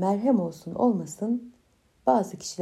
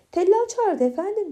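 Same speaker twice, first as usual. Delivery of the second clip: Keloğlan voice; 10 semitones higher than usual. Acted. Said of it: "demiş".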